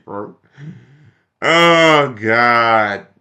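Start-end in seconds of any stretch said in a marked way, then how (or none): 1.4-3.1 loud drawn-out exasperated oh God; exasperated groan. Real, not acted. Vlogger groaning at Bernie's democracy line, loud exasperation. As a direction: groan it loudly in disbelief.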